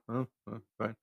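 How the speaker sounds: short, soft, unfriendly reply; curt, unfriendly. Retold or imitated. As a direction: toss off a reluctant short answer. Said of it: "One word answers, real short, not really friendly".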